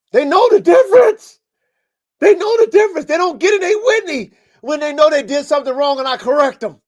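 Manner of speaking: loud and high-pitched, all fired up; fired-up yell. Real, not acted. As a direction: shout it out, all worked up. Fired-up rant about correcting dogs.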